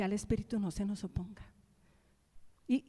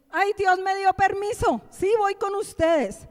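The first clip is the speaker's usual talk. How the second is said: gleeful Balaam; 8 semitones higher, 8 dB louder than usual. Retold or imitated. Balaam happy to go.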